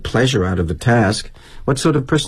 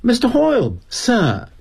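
put-on polite Brit kid; 12 semitones higher than usual. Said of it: "this kid says to me".

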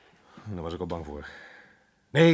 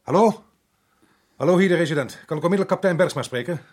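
raised voice on a bad phone line; loud into the phone; 5 semitones higher, 6 dB louder than usual. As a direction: shout into the receiver to be heard. Resident calls barracks by phone.

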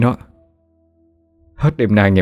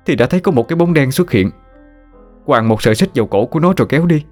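higher, urgent, rushing fearful account; a scared young man insisting on what he saw; frightened telling; 6 semitones higher, faster than usual. Acted. Frightened youth recounting ghost vision.

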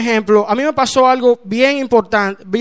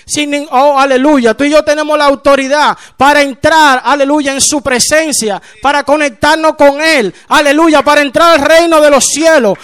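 raised, driving preacher's delivery; fervent preaching; 3 semitones higher, 5 dB louder than usual. Acted. Preacher telling the church they have authority from the King, fervently. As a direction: proclaim it with force to the congregation.

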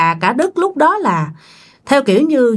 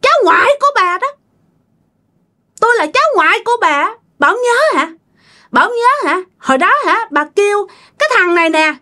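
very high, accusing possessed voice; shrill spirit voice; 11 semitones higher, faster than usual. Acted. Possessed son reveals himself to his grandmother, shrill. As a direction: confront her in a piercing put-on pitch. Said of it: "bà nói với cái giọng the thé nha".

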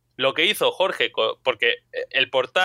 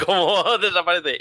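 laughing; 4 semitones higher than usual. Real, not acted. Laughing retelling.

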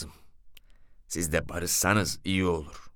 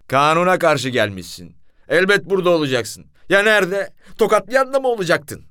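loud, rising mocking reproach, pitch climbing higher; scolding gendarme; 8 semitones higher, 7 dB louder than usual. Gendarme mocking the cook by the stove, loud scolding. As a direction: scold him louder with each question.

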